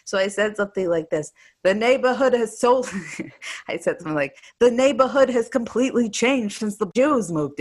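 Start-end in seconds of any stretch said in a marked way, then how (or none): 1.6-2.9 put-on louder, higher Yiddish-accented quip; lightly done Yiddish voice. 4.6-7.6 put-on louder, higher Yiddish-accented joke.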